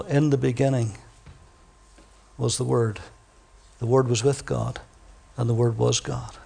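scripture reading. Acted. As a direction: read the verse low and reverent. Reads John's prologue.

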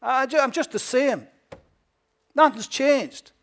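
high, loud whiny complaint; mock the flippant seeker's whine; half-hearted complainer. Preacher mocking a doubter's whiny excuse, high and loud. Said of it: "And then they say".

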